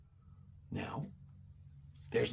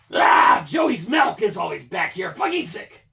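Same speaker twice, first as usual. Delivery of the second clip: loud, high yell of disgust; disgusted shout; 5 semitones higher, 6 dB louder than usual. Real, not acted. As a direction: shout in real revulsion. Finds old milk.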